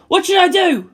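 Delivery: loud high panicked plea. Acted. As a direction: beg for orders in panic. Shinji panics.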